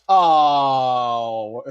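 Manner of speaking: loud startled gasp; surprised oh. Real, not acted. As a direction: react with shock. Shocked at AI reply.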